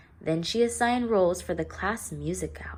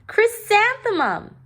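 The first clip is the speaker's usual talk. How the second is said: much higher, loud mocking reply; teasing answer; 8 dB louder than usual. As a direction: kids pointing out the joke. Students answer, giggling at her name.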